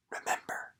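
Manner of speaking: breathless, voiceless whisper; Jessie's last word to Winnie, barely breathed. Acted. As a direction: breathe it out as a secret farewell. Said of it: "whispered the single word".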